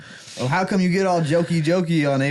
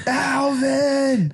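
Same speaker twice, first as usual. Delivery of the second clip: high, drawn-out exasperated call; mock parental yell, pitched way up; Dave calling Alvin; 10 semitones higher than usual. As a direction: call out for a naughty chipmunk, stretched high. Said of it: "I'm Dave".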